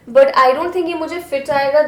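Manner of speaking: Hindi-English code-switching; switching languages midsentence. Real, not acted. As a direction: swap between English and Hindi mid-line.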